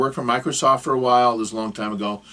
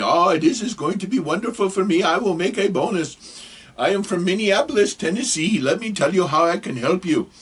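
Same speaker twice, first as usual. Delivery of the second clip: higher, eager fake Indian accent; bad Indian accent; 7 semitones higher than usual. Scammer delighted at a coming bonus, bad Indian accent. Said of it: "I'm sorry for the accent".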